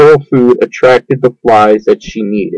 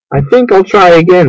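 bright hopeful pitch lift, higher; cheerfully; 6 semitones higher, faster than usual. A narrator's reading. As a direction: chirp it, ready to go again. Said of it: "said Wilbur cheerfully".

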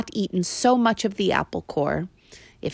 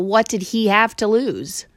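ordinary delivery, nothing marked

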